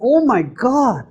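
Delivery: an exasperated "Oh my God" at the driver; mock road annoyance; exasperated groan. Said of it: "And then it's".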